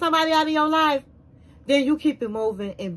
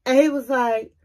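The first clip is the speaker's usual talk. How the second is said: ordinary talk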